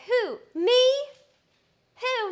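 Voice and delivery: voicing the puppy. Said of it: "He's like".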